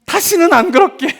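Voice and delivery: high-pitched mock childish promise; promising child. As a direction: imitate a child pleading with mom. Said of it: "그다음 붙는 말이 있죠". (다짐하며 비는 아이 흉내)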